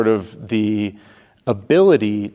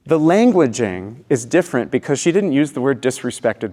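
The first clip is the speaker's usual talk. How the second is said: ordinary talk, nothing marked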